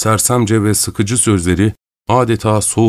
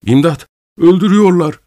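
a choked cry for help, much higher and louder; broken, desperate scream; 9 semitones higher, 4 dB louder than usual. A narrator's reading. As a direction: yell in panic while being strangled. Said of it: "diye haykırdı".

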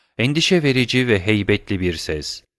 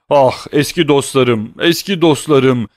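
ordinary delivery

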